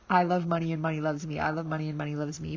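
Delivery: rattled off fast, mockingly; mock dumb rattle-off. Shown as a demonstration. Demoing how dumb the journal line feels, rattled off. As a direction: rush it like a silly chore. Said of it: "dumb to write down".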